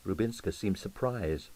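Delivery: ordinary delivery, nothing marked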